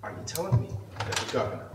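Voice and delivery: soft, deliberate questioning. Shown as a demonstration. Man re-asking an official his question, pointedly calm. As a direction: restate the question softly and patiently. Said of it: "conversation just like this".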